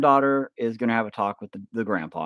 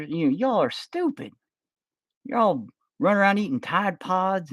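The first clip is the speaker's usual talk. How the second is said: grumpy grandpa; 10 semitones higher than usual. Pretend. Grandpa scolding his granddaughter, mock-grumpy old man voice. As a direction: ham up a cranky grandpa calling the kids dumb.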